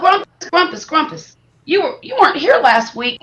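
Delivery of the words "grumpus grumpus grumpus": high, playful calling of a name. Real, not acted. Greeting a friend who missed last week, calling out. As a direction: sing out the name to greet him.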